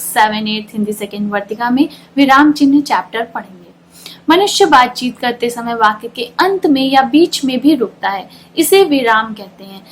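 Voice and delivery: ordinary talk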